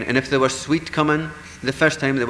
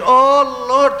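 high, loud gasp of dismay; a fairy struck by sudden panic; shocked cry; 12 semitones higher, 9 dB louder than usual. Acted.

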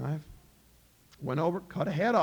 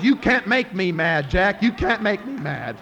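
insisting loudly; 2 semitones higher, 9 dB louder, slower than usual. Acted. King insists he is not mad.

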